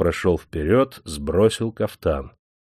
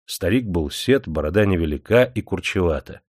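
usual speaking voice

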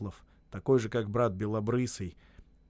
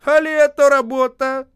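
furious shout; 14 semitones higher, 13 dB louder than usual. A narrator's reading. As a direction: roar the insult at the clumsy carpenters. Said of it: "кричал Осип".

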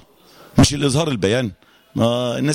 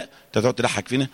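ordinary delivery

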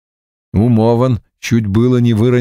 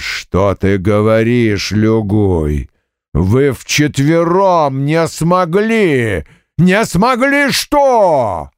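demon bull voice; 3 semitones higher than usual. Acted.

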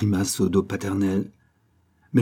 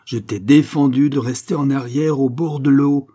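angry scolding; 6 semitones higher, 6 dB louder than usual. A narrator's reading. Father scolds son, twisting ear.

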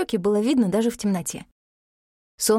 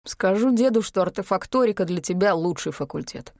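ordinary delivery, nothing marked

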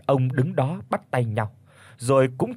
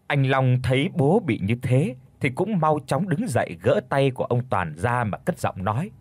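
ordinary delivery

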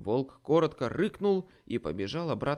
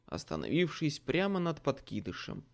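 usual speaking voice

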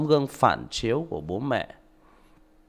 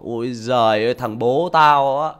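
loud, slow scornful jeer; mocking child; 7 dB louder than usual. Son mocking his father, loud scornful sneer. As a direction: sneer at the father like a grown kid. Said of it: "nó bảo".

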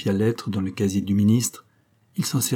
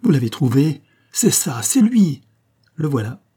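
louder, much higher joyful cry; 5 semitones higher, 4 dB louder than usual. Acted. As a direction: shout with delight at the find. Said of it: "poussa un cri de joie". (cri de joie)

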